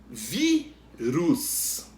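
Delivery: "Vírus" in two slow separate syllables, "vi" stressed; teacher splitting "Vírus" to show stressed "vi". Shown as a demonstration. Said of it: "a gente pronuncia com mais força".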